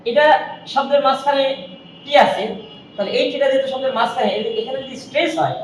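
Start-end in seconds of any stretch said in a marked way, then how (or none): none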